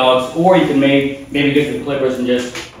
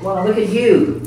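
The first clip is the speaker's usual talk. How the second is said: high sing-song pet voice; talking to a dog; 9 semitones higher than usual. Real, not acted. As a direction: coo at the dog. Vet greets the dog.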